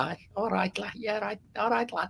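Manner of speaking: high-pitched mock Scouse greeting; Scouse accent. Putting on a Scouse voice for the Americans, Scouse accent. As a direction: Liverpool accent, cheeky and chirpy. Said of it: "Uh, Liverpool".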